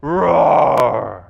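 low, loud drawn-out roar; lion's roar. Retold or imitated. Preacher as the lion facing down hyenas, roaring. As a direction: roar deep and long like a lion. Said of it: "and he roars".

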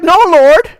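high, loud firm "No, Lord"; emphatic refusal. Acted. Peter refusing God's command, high loud insistence. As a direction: push back hard and fast. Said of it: "He's like".

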